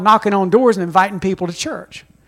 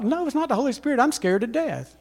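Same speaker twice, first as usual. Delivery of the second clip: scared confession; 3 semitones higher, 8 dB quieter than usual. Retold or imitated. Inner thought, scared.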